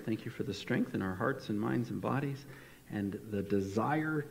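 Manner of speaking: soft, reverent prayer voice; hushed prayer. Acted. Pastor praying quietly before the sermon, hushed and reverent. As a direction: pray it gently to the Father.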